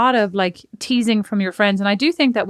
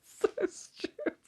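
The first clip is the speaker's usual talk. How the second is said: wheezing with laughter; laughing; 13 semitones higher, 15 dB quieter, slower than usual. Real, not acted. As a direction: squeak it out through silent laughter. Laughing at friend's teasing.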